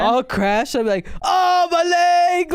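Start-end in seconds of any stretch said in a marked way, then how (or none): 1.2-2.5 high, loud, slow cry of pain; pained yell. Retold or imitated. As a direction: howl about the hurt leg. Crashed car, mock injury.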